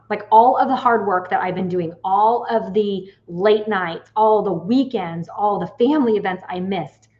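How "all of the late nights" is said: normal talking voice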